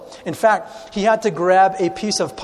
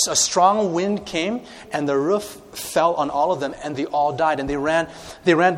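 normal talking voice

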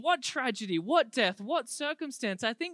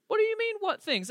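high-pitched mock disbelief; baffled, indignant comeback; incredulous; 12 semitones higher, 3 dB louder than usual. Acting out disbelief at Jesus' question.

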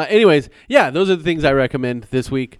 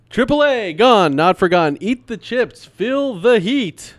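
hype announcer; 9 semitones higher, 6 dB louder, slower than usual. Acted. Ad-style hype tagline.

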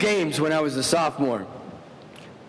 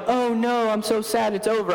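mock sad; 6 semitones higher than usual. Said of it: "not feeling emotional like".

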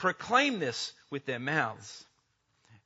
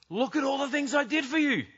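high proud plea; 10 semitones higher than usual. Acted. People presenting their resume.

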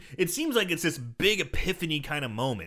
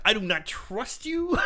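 slow, laughing suspicion; 10 semitones higher than usual. Real, not acted. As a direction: tease through laughter.

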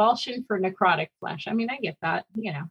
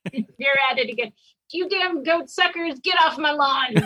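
put-on higher-pitched cranky old man yell; shouting at trespasser; 6 semitones higher than usual. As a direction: holler like a grumpy old man off his porch. Grumpy neighbour yelling at goats.

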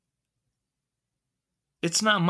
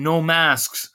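exaggerated mouthing; 6 semitones higher, 4 dB louder than usual. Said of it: "I start saying".

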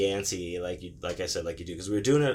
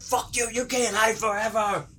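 high-pitched taunting shout; angry mock yell; 12 semitones higher than usual. Driver taunting kids he can't find, hammed-up angry yell. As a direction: threaten the hiders as a furious driver. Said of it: "he's just yelling".

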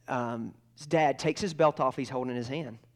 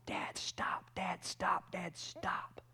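whispered, desperate pleading, acted out; tearful begging; 6 semitones higher, 10 dB quieter, much slower than usual. Boy begging his dad to stop beating himself, acted tearful whisper. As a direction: plead in a hushed, broken-up repetition. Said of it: "starts crying and begging his dad".

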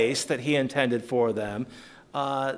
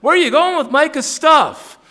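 high, loud indignant shout; crying out; 10 dB louder than usual. Retold or imitated. Micah's men crying out at the Danites, high loud indignant shout. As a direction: yell it high after the thieves. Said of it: "They cried unto the children".

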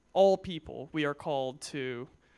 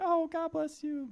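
homeless man's voice; 13 semitones higher, slower than usual. Retold or imitated. Youth speaker imitates homeless man's greeting, high put-on voice. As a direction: pitch way up like the homeless guy greeting you. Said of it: "That's actually what he sounded like".

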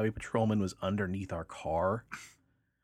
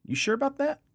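doubtful cop; 4 dB louder than usual. Retold or imitated. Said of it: "he's like".

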